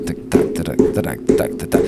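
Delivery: soft spoken tabla beat; whispered tick tack drumming; tabla syllables. Retold or imitated. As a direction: tap out the rhythm with your mouth. Imitating tabla rhythm.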